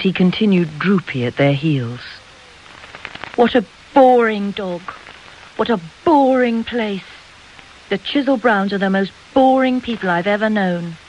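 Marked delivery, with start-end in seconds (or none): 3.4-11.0 higher-pitched bored complaint, leaning on "boring"; exasperated girl. A narrator's reading. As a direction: complain with childish scorn. Girl complains loudly in garden.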